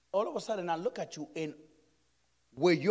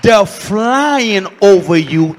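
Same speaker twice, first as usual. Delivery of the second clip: slow and much louder, drawn out; emphatic repeat; 18 dB louder, much slower than usual. Shown as a demonstration. Preacher repeating the dream so the church hears, loud and slow. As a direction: hammer it home for the church. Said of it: "You didn't hear what I said".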